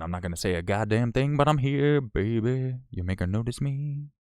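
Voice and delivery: playful put-on brag for a silent donor; voiced silent supporter. Streamer voicing a silent super chat, mock triumphant voice.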